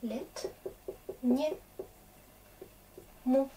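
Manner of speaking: each syllable set apart with pauses. Shown as a demonstration. Teacher showing students the syllables one by one. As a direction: break the word into separate syllables. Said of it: "teacher splits word into syllables".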